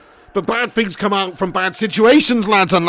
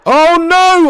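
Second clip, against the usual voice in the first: high, loud cry of dismay; alarmed shout; 10 semitones higher, 8 dB louder than usual. Real, not acted. Gamer to himself as a goal threatens, alarmed shout. Near goal against during game.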